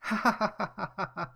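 put-on high, loud girlish laugh; burst of laughter. Mia can't help laughing at the bear, high and loud. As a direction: laugh out in spite of yourself. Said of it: "dass Mia wieder lachen muss".

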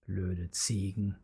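low, soft, slow grumble under the breath; muttered. Acted. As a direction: mutter it so they barely hear. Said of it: "murmelte Paule".

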